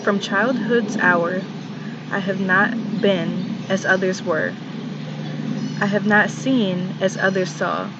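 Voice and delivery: measured recital with long pauses between lines; poetry reading. Acted. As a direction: read the poem in short, deliberate phrases. Recites a poem.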